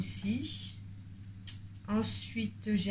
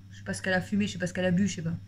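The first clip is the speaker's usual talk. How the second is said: ordinary talk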